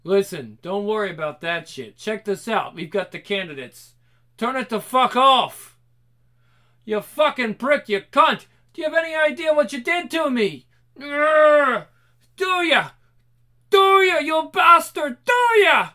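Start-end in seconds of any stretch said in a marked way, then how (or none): 4.4-10.7 loud, high furious yelling; enraged shouting. Acted. Enraged confrontation with captive. 11.0-11.9 loud, high rage roar; furious roar. Acted. Enraged roar.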